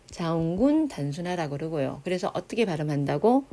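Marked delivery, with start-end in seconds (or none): none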